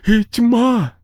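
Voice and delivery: high kid in a mock rescue; playacting child. A narrator's reading. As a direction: overplay heroic rescue like kids do. Children playing dying scene.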